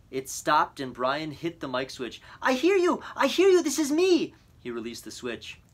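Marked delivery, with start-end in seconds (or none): 2.4-4.3 high, loud desperate answer; desperate reply. A narrator's reading. Boy answering the radio operator, frantic and loud. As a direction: blurt it out, frantic.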